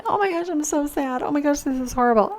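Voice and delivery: high, whiny mock distress; mock sad self. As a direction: parody her own upset reaction. Mocking her own upset self.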